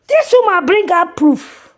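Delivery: high, loud, slow outraged yell; angry shout. Real, not acted. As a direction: hurl it out in disgust. Ranting about the woman's proof.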